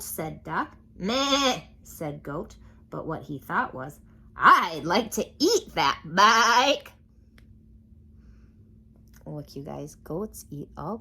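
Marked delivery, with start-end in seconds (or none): 1.0-1.6 higher, louder bleat; goat bleat. A narrator's reading. Goat answering Duck, bleat. 4.3-6.9 louder, slow greedy goat; hungry goat. A narrator's reading. Goat wanting to eat Duck's bike, slow greedy voice. Goat's thought.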